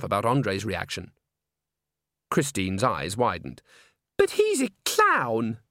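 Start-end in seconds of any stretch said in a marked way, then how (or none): 4.2-5.6 high, drawn-out disbelief; incredulous diva. A narrator's reading. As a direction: scoff in a high, affected girlish voice. Christine's incredulous reply to Agnes.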